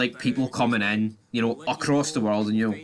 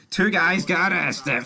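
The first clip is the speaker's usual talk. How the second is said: delighted higher slow repeat of arrested; gleeful, higher, slower repeat; 7 semitones higher, 3 dB louder, slower than usual. Real, not acted. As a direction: repeat it slowly, higher and louder with glee.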